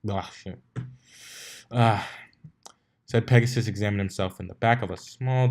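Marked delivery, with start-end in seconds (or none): none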